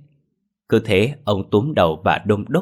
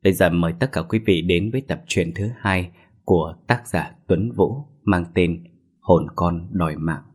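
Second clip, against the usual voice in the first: ordinary delivery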